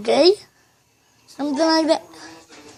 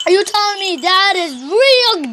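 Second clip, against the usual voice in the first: usual speaking voice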